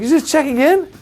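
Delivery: very high disbelieving question; can't believe it, rising; incredulous echo. Retold or imitated. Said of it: "I was like".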